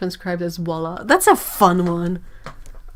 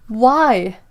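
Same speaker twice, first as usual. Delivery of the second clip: ordinary talk, nothing marked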